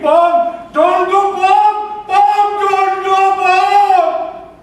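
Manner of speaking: desperate high, loud scream; long, pleading screams at full force. Retold or imitated. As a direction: scream after someone driving away. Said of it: "I screamed".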